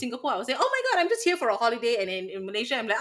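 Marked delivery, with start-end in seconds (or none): 0.6-2.0 high, quick tourist gush; put-on holiday excuse, pitched up fast; feigned tourist excitement. Feigns being a passing tourist.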